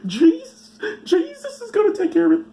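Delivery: high, squeaky mock-pious voice; naive believer. Comic mocking naive believers trusting Jesus, squeaky falsetto. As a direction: chirp it like a simple-minded believer.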